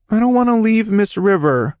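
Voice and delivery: put-on high, wavering little boy's voice; a small boy near tears; quivering child voice. A narrator's reading. Boy begging his dad not to leave, quivering. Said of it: "his voice quivering".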